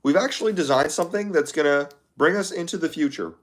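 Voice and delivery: Mark Zuckerberg. Acted. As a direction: pitch it up like a tech boss presenting a product. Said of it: "Mark Zuckerberg?".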